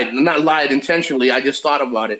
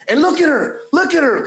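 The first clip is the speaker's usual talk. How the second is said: excited pointing out; 9 semitones higher than usual. Retold or imitated. Pointing out a woman.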